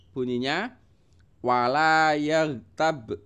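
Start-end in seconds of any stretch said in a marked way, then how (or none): 1.4-3.2 wala yaghtab slow, the b bounced at the end; teacher demonstrating qalqalah; bouncing b. Shown as a demonstration. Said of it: "Baknya itu mantul ya".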